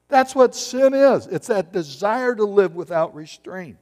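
ordinary talk

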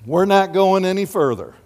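usual speaking voice

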